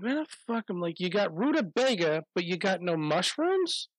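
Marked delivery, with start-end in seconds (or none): 0.0-0.6 exasperated. Retold or imitated. Exasperated at the missing mushrooms. 1.0-3.9 indignant rant. Retold or imitated. Ranting at the store.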